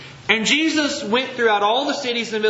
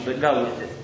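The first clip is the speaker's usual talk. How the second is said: ordinary delivery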